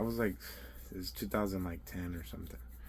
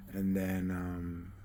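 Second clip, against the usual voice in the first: usual speaking voice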